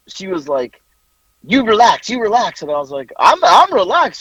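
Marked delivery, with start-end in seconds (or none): 1.4-2.6 quarrelsome retort. Retold or imitated. Retelling her side of the quarrel. 3.2-4.2 high, slow, emphatic denial; mock-heated self-defence, louder; defensive protest. Retelling his defensive reply in quarrel.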